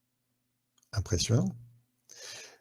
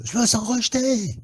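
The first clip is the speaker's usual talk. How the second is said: mock hurt voice; 14 semitones higher, 5 dB louder than usual. Quoting a hurt inner voice.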